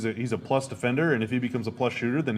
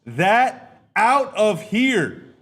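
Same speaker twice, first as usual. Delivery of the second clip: much higher, louder stretched-out rejection call; blocked-shot yell; 11 semitones higher, 7 dB louder, much slower than usual. Retold or imitated. Musa Cisse's block voiced as a high, loud triumphant yell. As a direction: holler it like swatting the shot away. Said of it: "Musa Cisse said".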